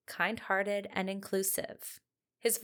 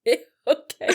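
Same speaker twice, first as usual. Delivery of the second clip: awkward; 5 semitones higher, 11 dB louder than usual. Retold or imitated. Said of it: "I'm like".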